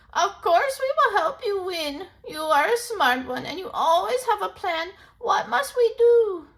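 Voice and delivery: high, loud eager promise; ready for the plan; old toad ready to help. Acted.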